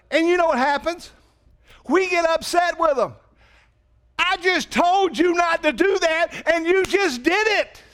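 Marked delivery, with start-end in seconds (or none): 4.2-7.8 exasperated parent, pitched higher; a parent scolding a kid who disobeyed. Retold or imitated.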